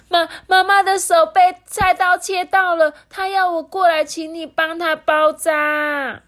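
put-on very high, loud child voice; loud outburst. A narrator's reading. Little Lemon finds courage to ask auntie, loud cry. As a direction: blurt it all out at full voice, urgently. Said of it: "就大声地说".

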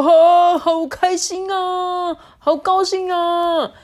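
high, gleeful pig shriek; acted delight, high and drawn out; happy squeal. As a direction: squeal with joy, stretching out the words. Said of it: "快乐的尖叫说".

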